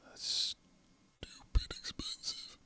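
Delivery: hushed aside; whisper. Real, not acted. Streamer shocked at the price, whispered. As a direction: whisper it under your breath.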